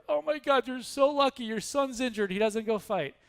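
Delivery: high-pitched envious-sounding neighbor, easing down; acted excited congratulations. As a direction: exclaim at the farmer's luck. Neighbor runs over to congratulate farmer.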